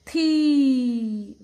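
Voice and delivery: थी loud, with a long ee; long-vowel demonstration. Shown as a demonstration. Teacher joins letter and matra, long loud थी. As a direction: hold the vowel in थी, loudly. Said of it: "तो क्या बनेगा?".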